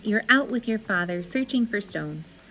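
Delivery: usual speaking voice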